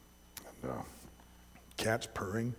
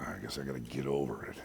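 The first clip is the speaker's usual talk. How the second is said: lower, softer resigned mutter; resigned sigh; 11 semitones lower, 8 dB quieter, faster than usual. Retold or imitated. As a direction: give up grudgingly. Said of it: "cat resigns".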